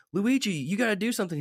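high-pitched imitation of an urging movie heroine; lightly voiced Daisy. Daisy urging Luigi to act, lightly mimicked. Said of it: "And she's like".

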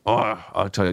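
ordinary talk, nothing marked

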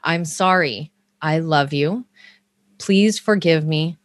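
normal talking voice

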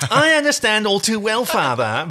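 put-on high, whiny sulky teen; mopey teen. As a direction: sulk it out like a moody teenager. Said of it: "I'm gonna make him into mopey teen".

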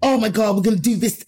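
imitating high, gushing industry praise; mock gushing spiel. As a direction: lay on the flattery, mockingly. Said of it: "given me the spiel".

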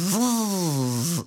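buzzing imitation of flapping straps; strap noise. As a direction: buzz like straps in the wind. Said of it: "you know, the strap noise".

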